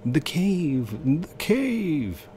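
high, drawn-out hammed-up exclamation; mock dramatic cry. Player hailing the cave, mock dramatic cry.

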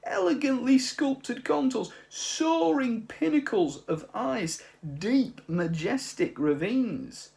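high, loud, slow and impassioned; indignant old artist. Acted. Old man defending his glaciers to the mice, high and impassioned. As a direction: plead for the glaciers as high art.

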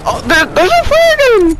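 very high, squealing excitement; real delighted surprise; excited surprise. Real, not acted. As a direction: blurt it out in a high-pitched thrill. Spots the flare gun he wanted.

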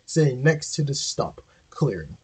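usual speaking voice

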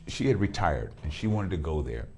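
normal talking voice

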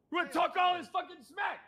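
very high-pitched shouted challenge; angry yelling. Pretend. Sheriff confronts the staff over smack talk, angry yelling. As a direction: shout it as a high, furious taunt. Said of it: "you come in here yelling".

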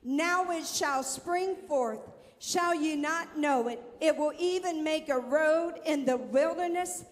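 proclaiming scripture. Acted. Preacher proclaiming scripture to church.

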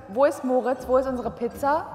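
usual speaking voice

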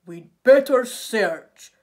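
very loud, slow tiger voice; resolute tiger. A narrator's reading. Tiger decides to join the search, resolute. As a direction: declare it slowly and forcefully.

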